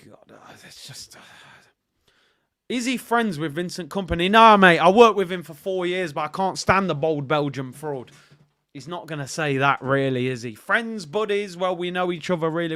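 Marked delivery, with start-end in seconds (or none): 0.1-1.2 exasperated whisper. Real, not acted. Exasperated at reply. 4.3-8.1 loud blunt retort in someone else's mouth; loud, blunt mock reply; mock blunt answer. Voicing Arteta's imagined honest answer.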